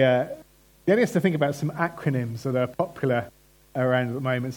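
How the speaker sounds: ordinary talk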